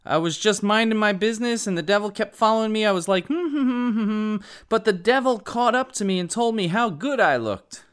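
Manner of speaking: high-pitched, brisk, hammed-up comic woman; Geraldine retorting. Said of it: "Geraldine retorts".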